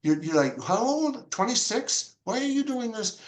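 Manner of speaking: put-on asking a young artist, puzzled and high; surprised asking. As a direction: ask in wonder why she came. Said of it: "I said".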